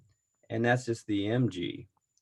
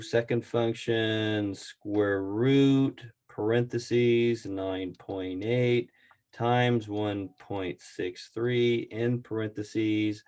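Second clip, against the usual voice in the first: slowly reading out calculator keys; slow keypad dictation; much slower than usual. Real, not acted. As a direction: spell out each keypress, slow. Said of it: "I got to get my calculator out".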